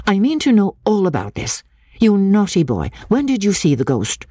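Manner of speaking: high-pitched, challenging interrogation; satirical defiance. A narrator's reading. Miss Halcombe questions little Jacob directly, satirical defiance. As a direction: question the child with mock severity, pitched high. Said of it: "with an air of satirical defiance".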